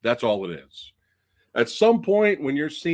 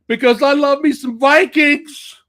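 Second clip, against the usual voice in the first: excited; 13 semitones higher, 11 dB louder than usual. Real, not acted. Gushing about loving Vikings.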